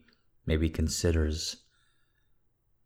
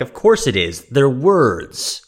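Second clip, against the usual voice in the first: mock rant; 8 semitones higher, 6 dB louder than usual. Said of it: "I'm like".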